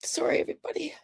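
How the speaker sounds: squeezed, breaking voice fading to whispers; real crying. Real, not acted. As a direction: choke out the apology through tears. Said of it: "I'm gonna cry for you".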